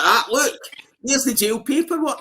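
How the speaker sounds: high, eager show-and-tell; eager proof. Retold or imitated. Showing the barmaid his prison papers, high and eager. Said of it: "And I'm like".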